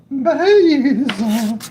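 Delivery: high, loud goofy one-word answer; silly high answer. Retold or imitated. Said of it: "And it's like".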